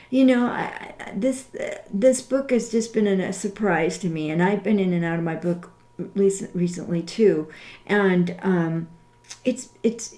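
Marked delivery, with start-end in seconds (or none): none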